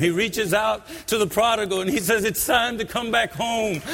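impassioned revival preacher pleading with listeners; preaching at full force. Acted. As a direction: build the altar call with fervour. Preacher rising into altar call.

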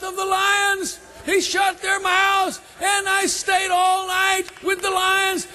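high, sustained preacher's shout as Daniel; triumphant shout. Acted. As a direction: shout the good news up to the king. Daniel acted shouting to king.

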